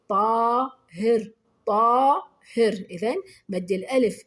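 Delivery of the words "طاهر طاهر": "طاهر" with a long, loud "طا"; stretched alif. Shown as a demonstration. Teacher showing pupils madd al-alif, stretched alif. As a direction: slowly draw out the first vowel of "طاهر". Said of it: "هذا نطلق عليه مد الألف".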